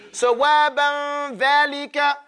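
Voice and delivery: sawaban dhalika drawn out slowly; slow teaching demo. Shown as a demonstration. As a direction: model it slowly for the class. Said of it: "so man dhal ladhi".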